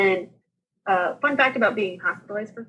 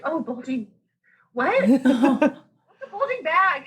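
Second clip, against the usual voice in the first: high-pitched, laughing disbelief; retold bewildered question, pitched up into a laugh; baffled; 5 semitones higher, slower than usual. Patient questioning her doctor's alarming news, baffled.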